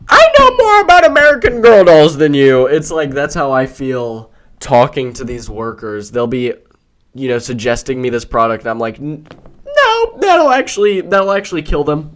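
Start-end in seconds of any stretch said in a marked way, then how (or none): 0.1-2.7 high, loud quoted yell; Vine impression. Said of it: "have you ever seen that, that Vine?". 9.0-12.1 high-pitched, exasperated objection; alarmed protest. Retold or imitated. Arguing with pushy store worker.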